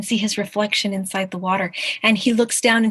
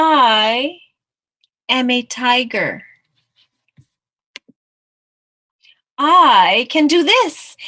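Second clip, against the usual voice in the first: loud, drawn-out, higher-pitched declaration; bold boast; 5 semitones higher, 10 dB louder, much slower than usual. Acted. Mouse insisting he is a tiger, loud and boastful. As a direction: proclaim it with a long, swooping "I".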